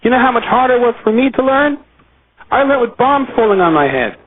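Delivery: high, pressing rebuke of a father; scolding father. Retold or imitated. A father scolds his child about learning: high mock rebuke. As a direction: lecture the child about the hardships he had. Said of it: "if a father says to a child".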